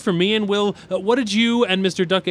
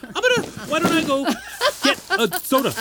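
flustered laugh; 10 semitones higher, faster than usual. Acted. Flustered, laughing at awkward moment.

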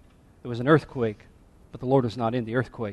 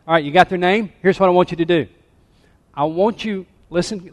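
ordinary talk, nothing marked

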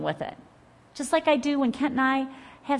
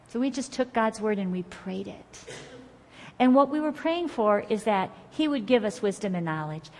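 ordinary delivery

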